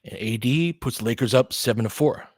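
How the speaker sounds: running game call, steady and brisk; live play-by-play. Acted. Streaming the Lakers game to his viewers, live play-by-play. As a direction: call each possession as it happens.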